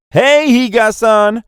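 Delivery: loud, high, stretched call-out; yelled greeting. A narrator's reading. Friends hailing her in the street, loud high yell. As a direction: holler the name across a crowd. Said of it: "Naturally, they yelled".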